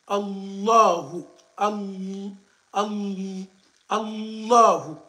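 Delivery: "Allahu" very slow, doubled "ll" stressed loud; with shidda. Shown as a demonstration. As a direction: hold the doubled "ll" in "Allahu" and drag it out. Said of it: "il faut le dire avec shidda".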